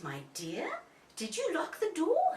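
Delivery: lightly voiced fussing mother bear; rising worried question; Mummy Bear. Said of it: "And Mummy says".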